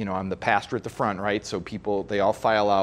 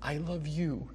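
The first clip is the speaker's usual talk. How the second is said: soft, higher-pitched voice of a consoling daughter; tender reassurance; 5 semitones higher, 6 dB quieter than usual. Retold or imitated. Bride comforting her crying dad after the wedding, imitated tender reassurance. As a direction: gently soothe him, keeping it hushed.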